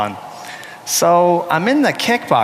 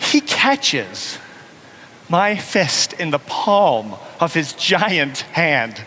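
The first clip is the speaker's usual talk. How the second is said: usual speaking voice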